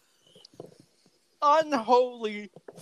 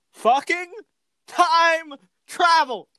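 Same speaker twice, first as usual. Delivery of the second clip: exasperated outburst; 6 semitones higher, 5 dB louder, slower than usual. Real, not acted. Exasperated rant about plot twist.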